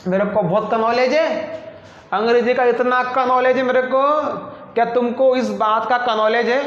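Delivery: नॉलेज with a sounded K, mock showing off; mock mispronunciation. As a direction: say नॉलेज as "ka-nowledge", playfully overdone. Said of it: "अबे का नॉलेज नहीं मेरे भाई".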